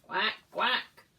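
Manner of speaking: loud quacking; loud playful duck call. Acted. Duck calls in picture book.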